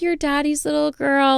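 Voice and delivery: dad fake-nice to his baby in the checkout line; mock sweet dad. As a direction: coo it like a doting father. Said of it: "being so nice".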